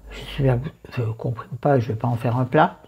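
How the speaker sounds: normal talking voice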